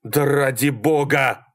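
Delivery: exasperated shout, stretched out; gasping yell. A narrator's reading. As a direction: yell it slowly, gulping for air. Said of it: "орал Малькольм, глотая воздух".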